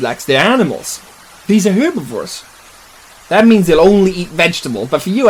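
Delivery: ordinary delivery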